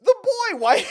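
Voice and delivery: high, loud incredulous yelp; loud, high squawk breaking into laughter; shocked outcry. Real, not acted. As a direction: cry out in amused disbelief. Shocked reaction to a character design.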